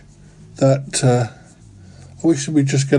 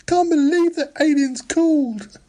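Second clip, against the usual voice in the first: squeaky high burst of delight; giddy disbelief; 13 semitones higher than usual. Acted. Caller thrilled that the aliens phoned, high giddy disbelief. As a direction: let it fly up in astonished glee. Elated after the alien call.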